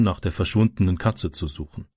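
ordinary delivery, nothing marked

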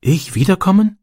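put-on small, high, timid boy's question; shy boy. A narrator's reading. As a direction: ask it shyly, hoping for yes. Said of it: "fragte er schüchtern".

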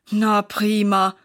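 slow, heavy sarcastic sigh; weary, stretched-out groan. A narrator's reading. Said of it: "seufzt Sarah".

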